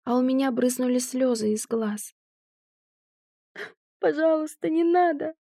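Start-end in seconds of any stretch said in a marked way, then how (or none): 4.0-5.3 high, tearful begging; sobbing plea. Acted. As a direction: beg through bursting sobs. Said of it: "разрыдалась в голос".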